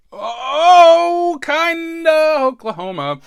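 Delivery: sudden high loud wail, hammed-up; a burst of fake despair, high and loud; mock cry. While recalling the cold sores coming off, a mock wail.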